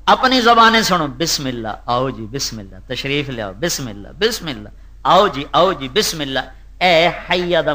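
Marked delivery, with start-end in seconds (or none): 1.1-6.4 quiet, hospitable "بسم اللہ"; soft welcoming. Pretend. Preacher voicing gracious hosts, quiet and welcoming. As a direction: welcome a guest softly. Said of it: "حیا کا مطلب کیا ہے".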